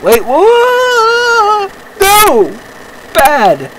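very high, loud scream of alarm; panicked yelp. Real, not acted. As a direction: let it leap into a high shriek. Tank turns on the player.

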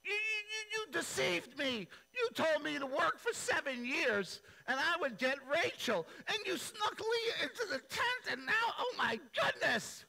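very high and loud outraged wail; cheated groom shrieks at father-in-law; furious whine. Retold or imitated. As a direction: screech at him for the trick. Jacob continues ranting.

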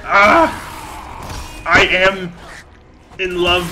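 high, loud ecstatic shouting; crowing in wild triumph; manic glee. Real, not acted. Chopping goblin feet, high loud ecstatic shouts.

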